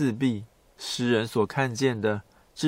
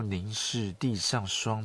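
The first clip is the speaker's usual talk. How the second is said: poem recitation; 3 semitones lower, 6 dB quieter than usual. Shown as a demonstration. Quoting a Tang poem.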